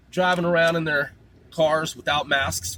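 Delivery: usual speaking voice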